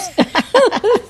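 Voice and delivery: squeaky laugh bursting out; high giggle. Real, not acted. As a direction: giggle helplessly. Laughing at own choking.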